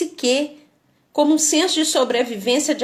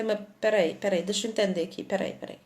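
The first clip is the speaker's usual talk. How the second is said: whispered wait; 3 semitones lower, 9 dB quieter, faster than usual. Real, not acted. Stopping to understand.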